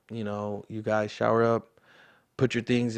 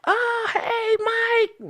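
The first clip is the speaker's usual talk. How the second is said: much higher, squealing mom voice; excited mom; 3 dB louder, slower than usual. Retold or imitated. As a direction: play an overjoyed mother shrieking hello. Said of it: "My mama is so full of energy".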